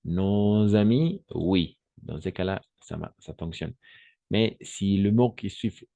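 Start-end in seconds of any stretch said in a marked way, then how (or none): 0.0-1.2 slow, stretched "no-z-amis"; liaison in "nos amis". Shown as a demonstration. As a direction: draw out the z link in "nos amis". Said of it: "On va faire la liaison". (« nos amis », liaison appuyée et lente)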